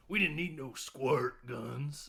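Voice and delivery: slow, hammed-up old-timer grumble; grumpy grandpa. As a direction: drag it out like a scornful grandpa. Grandpa rant's closing line.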